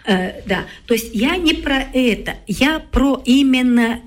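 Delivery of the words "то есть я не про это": ordinary talk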